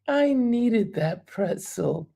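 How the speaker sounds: "I needed that pretzel" said slowly, each word weighed; slow it down and savor "I needed that pretzel"; slow repeat. Real, not acted. Host repeating viewer's line slowly, musing.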